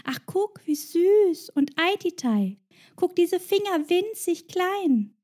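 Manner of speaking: high, slow cooing over tiny fingers; delighted squeal. Retold or imitated. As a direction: gush like a charmed stranger. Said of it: "einen entzückten Schrei".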